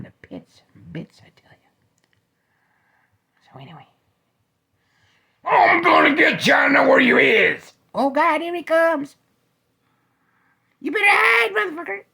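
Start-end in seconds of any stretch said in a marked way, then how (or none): 5.4-12.0 villain shout. Acted.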